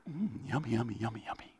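soft, hushed, savoring yummy sounds; broad singsong kids theatre. Pretend. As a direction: coo it like tasting treats with a toddler. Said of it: "I make weird noises".

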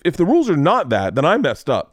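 high, emphatic self-defence; retold indignant arguing. Parent arguing rules with Miss Garcia, indignant and high. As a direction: argue the point, punching key words.

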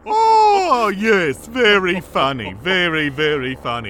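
loud, sarcastic very funny; mock offence at the joke. Real, not acted. Offended by joke about his mother.

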